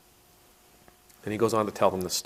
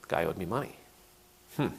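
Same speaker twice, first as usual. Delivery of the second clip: ordinary talk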